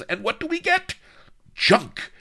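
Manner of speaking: shrieking in rage, pitched very high and loud; furious shout. Acted. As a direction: scream it like a tyrant in a tantrum. Villain raging at his men.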